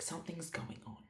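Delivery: very soft, acted night-time hush; hushed retelling. Wife telling sleepy husband something's up, acted hush. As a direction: keep it down, as if waking someone.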